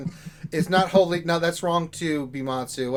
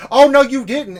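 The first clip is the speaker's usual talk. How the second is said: sassy McVenom; 9 semitones higher, 12 dB louder than usual. Retold or imitated. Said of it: "McVenom would pop up".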